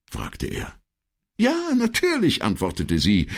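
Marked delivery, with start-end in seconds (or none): 1.4-2.4 much higher-pitched secretary's answer; secretary figure. Acted. Secretary answers the commissario's question, high-pitched.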